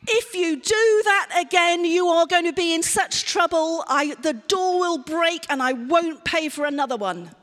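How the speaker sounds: loud, high threatening telling-off; cross parent threatening a child over the door; threatening scold. Acted. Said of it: "what about".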